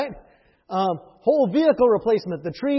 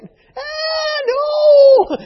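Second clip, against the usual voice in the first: much higher, much louder shriek of a stingy Scotsman; screaming inner Scotsman; 11 dB louder than usual. Retold or imitated. The little Scotsman in him screams at a big bill, a high, loud anguished cry. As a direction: let the penny-pinching Scotsman in you cry out in pain. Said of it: "There's a little Scotsman in me that screams".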